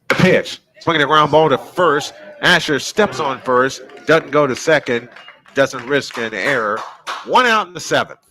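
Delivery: loud, punchy baseball call; live play-by-play. Real, not acted. Sportscaster calls a ground ball to first, loud play-by-play. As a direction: call the play loudly as it happens.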